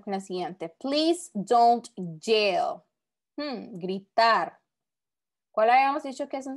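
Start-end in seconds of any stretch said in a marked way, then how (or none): 0.7-2.8 drawn-out, word-by-word read of the sentence; very slow example reading. Shown as a demonstration. Teacher reading the next example to students, very slow. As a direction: stretch every word so the class can follow. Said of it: "Ahora vamos a la siguiente".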